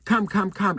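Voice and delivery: retold desperate plea; urgent plea. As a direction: beg him to hurry over. Begging husband at VIP entrance.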